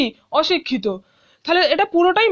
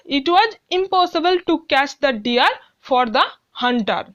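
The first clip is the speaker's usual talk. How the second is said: reads the sentence very slowly, word by word; slow classroom read-aloud; slow dictation reading; much slower than usual. Shown as a demonstration. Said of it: "teacher reads completed sentence".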